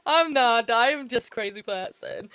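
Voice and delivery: playful jab at yourself; self-mocking. Real, not acted. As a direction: tease yourself out loud. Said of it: "I am a bit of a perfectionist".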